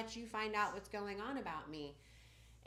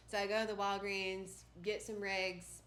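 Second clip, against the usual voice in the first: ordinary talk, nothing marked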